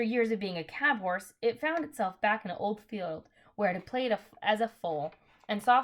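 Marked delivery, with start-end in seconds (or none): none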